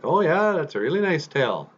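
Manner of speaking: high, obliging reply; polite bear. Acted. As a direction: humour a show-off with lifted pitch. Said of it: "And Makwa's like".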